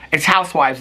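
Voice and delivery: ordinary talk, nothing marked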